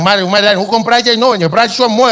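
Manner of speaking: an Afrikaner confused by fluent Afrikaans; baffled Afrikaans man. Retold or imitated. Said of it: "Hij zegt".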